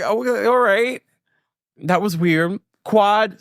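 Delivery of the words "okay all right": high, unsure mock reaction; awkward okay. Host reacting to a weird moment, high awkward okay. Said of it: "I was like".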